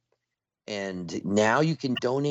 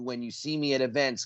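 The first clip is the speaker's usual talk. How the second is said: ordinary talk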